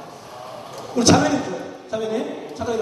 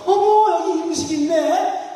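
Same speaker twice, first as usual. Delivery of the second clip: 어머 높게 터뜨리며 반색하는 흉내; 시식 코너를 발견한 아내처럼 들뜬 감탄; delighted surprise; 5 semitones higher, slower than usual. Retold or imitated. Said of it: "가면서 그러냐면". (시식 코너를 발견하고 반색하는 아내 흉내)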